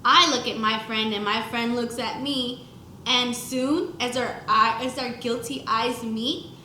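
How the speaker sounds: ordinary talk, nothing marked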